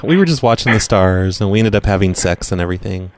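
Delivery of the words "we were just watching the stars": ordinary talk, nothing marked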